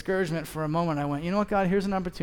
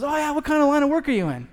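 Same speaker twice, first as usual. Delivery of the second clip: higher, louder eager small talk; chatty dad; 9 semitones higher, 5 dB louder than usual. Retold or imitated. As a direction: talkative dad on the bench. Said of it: "He goes".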